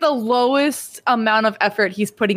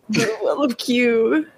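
slow, ominous announcement; dramatic ominous; slower than usual. Pretend. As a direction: intone it like a grand mystery. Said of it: "Oh, my God".

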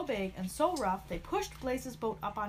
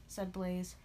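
plain reading voice

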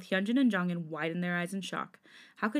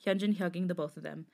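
ordinary delivery

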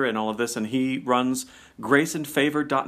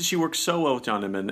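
usual speaking voice

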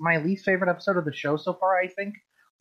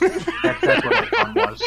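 laughing; 4 dB louder than usual. Real, not acted. Laughing at the joke.

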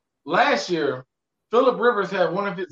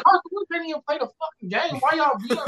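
incredulous retort; 7 semitones higher than usual. Retold or imitated. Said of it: "And I'm like".

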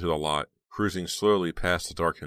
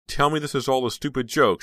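high, loud trembling plea; 9 semitones higher, 7 dB louder than usual. Acted. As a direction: let your voice tremble, pleading. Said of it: "her voice trembling".

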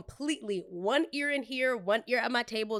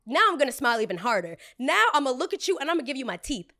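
defiant glee; 4 semitones higher, 7 dB louder, faster than usual. Real, not acted. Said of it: "describing smiling harder".

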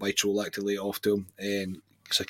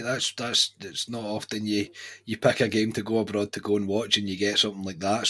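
normal talking voice

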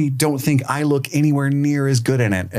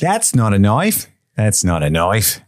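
slow Australian drawl; broad Australian movie-hero parody; Australian accent; 2 semitones lower than usual. Acted. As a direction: do a slow Crocodile Dundee Aussie. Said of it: "You do a Crocodile Dundee".

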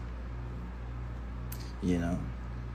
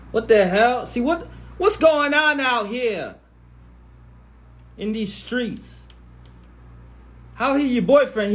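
1 incredulous; 8 semitones higher than usual. Real, not acted. Incredulous rant.

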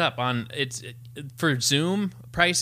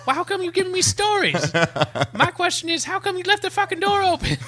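high, excitable Sinbad bit; Sinbad impression; 13 semitones higher than usual. Retelling the Sinbad math bit to cohost, high excited impression. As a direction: squeal the joke way up like Sinbad. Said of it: "where he's like".

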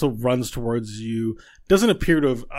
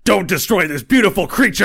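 high, loud outraged yell; angry shout; 9 semitones higher, 13 dB louder than usual. Acted. Varel charges at the party shouting in outrage. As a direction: charge in bellowing in defense. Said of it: "Varel starts bearing down and goes".

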